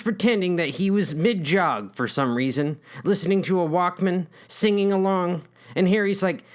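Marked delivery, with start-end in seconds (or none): none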